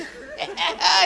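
high burst of laughter; a rising high laugh. Real, not acted. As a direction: laugh out at the picture. Laughing at the scene.

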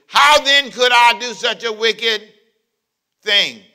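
loud, high, slow and forceful; thundering preaching. Shown as a demonstration. Preacher proclaiming Joseph's refusal, loud and high. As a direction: proclaim it from the pulpit. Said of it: "Watch this".

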